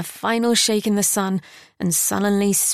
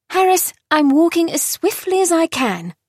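put-on higher annoyed girl's voice; a princess snapping back at her tutor in a higher voice; irritated princess; 9 semitones higher, 4 dB louder than usual. A narrator's reading. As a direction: answer him with impatience. Dinah retorts to Harris.

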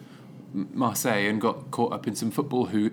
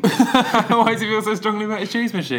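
higher, louder question through laughter; laughing incredulity; 9 semitones higher, 7 dB louder than usual. Real, not acted. Co-host reacting to the boyfriend hating the machine, real laughing incredulity. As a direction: burst out laughing while asking.

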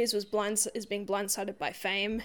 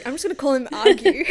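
loud, amused giving up; cracking up while settling on a nickname; laughing; 5 semitones higher, 11 dB louder, faster than usual. Real, not acted. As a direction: laugh through the words, louder. Gives up on name, laughing.

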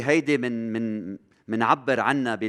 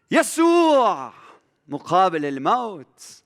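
a long, high, drawn-out cry of Jesus' name, much louder; exalted cry; 8 semitones higher, 8 dB louder, slower than usual. Acted. Preacher to congregation, proclaiming Jesus against death, high and loud. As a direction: proclaim the name, stretching it slowly.

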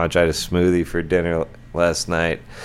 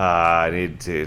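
ordinary talk, nothing marked

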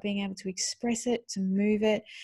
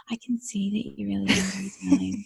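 gentle parent whisper. Retold or imitated. Gentle parent to raging child, hushed whisper. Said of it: "the parent's like".

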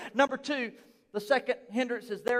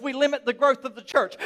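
ordinary talk, nothing marked

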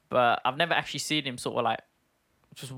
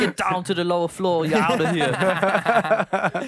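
higher, louder, very slow action-hero voice; Schwarzenegger impression; 5 semitones higher, 5 dB louder, much slower than usual. Joking that Arnold should be an elevator assistant, slow booming action-hero voice. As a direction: boom it out like a muscle-bound action hero. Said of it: "He should be like an elevator assistant".

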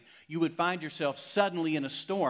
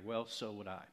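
ordinary talk

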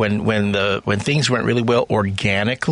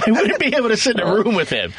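high, quick words through laughter; laughing; 11 semitones higher, faster than usual. Real, not acted. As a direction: say it while cracking up. Laughs before and after this line.

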